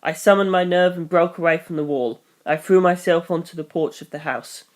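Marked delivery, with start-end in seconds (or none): none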